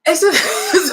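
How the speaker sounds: real voice crack, high and loud. Real, not acted. Apologising to viewers mid-point, voice cracking. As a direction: voice jumps up loudly and breaks into a laugh. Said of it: "sorry, my voice cracked".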